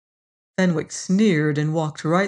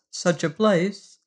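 ordinary delivery

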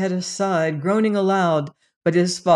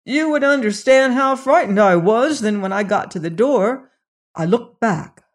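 raised, louder, shaken voice; frightened servant; 4 semitones higher, 4 dB louder than usual. A narrator's reading. Servant admits to the doctor how frightened he was, shaken. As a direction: still scared as he tells it.

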